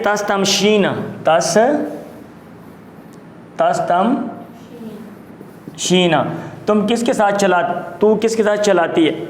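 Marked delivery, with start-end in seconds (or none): 1.2-6.1 تس, then تس تم, then شین, with long gaps; syllable-by-syllable dictation. Shown as a demonstration. Teacher dictates the word in pieces.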